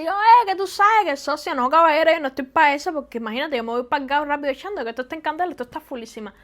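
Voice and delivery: loud opening, rapid-fire Cuban street slang; super-fast Cuban. Shown as a demonstration. Newcomer talking to Mexicans in super-fast Cuban. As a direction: rattle it off in thick Cuban, letters dropped. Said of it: "súper rápido, así".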